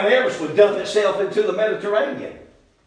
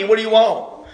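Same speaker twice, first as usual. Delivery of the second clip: ordinary talk, nothing marked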